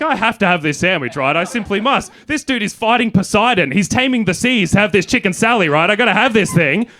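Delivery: loud, high, hammed-up determination; worked up, pitched high and loud; fired-up. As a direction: build to an urgent must-have rant. Acting out his sandwich craving.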